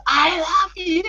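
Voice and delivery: very high-pitched mock scream; imitated scream. Fan retells idol's farewell, high imitated scream. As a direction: squeal it like an idol screaming after someone. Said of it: "she screams at the top of her lungs".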